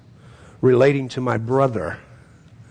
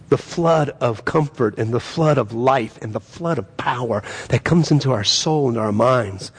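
normal talking voice

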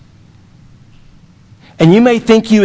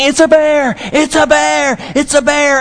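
put-on high panicked kid yelling about a bear; retold kid's yell; 8 semitones higher than usual. Little brother caught by dad playing bear, high mock yelling. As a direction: cry out in mock alarm, pitched way up.

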